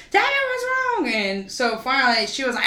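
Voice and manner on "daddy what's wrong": much higher alarmed question; mock panicked mom. Mom answering dad's call, high panicked question. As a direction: squeak it out in alarm.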